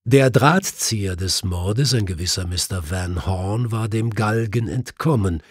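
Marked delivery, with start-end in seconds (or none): none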